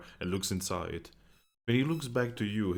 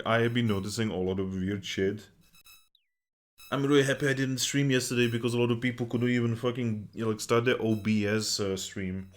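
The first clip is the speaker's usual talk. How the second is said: ordinary talk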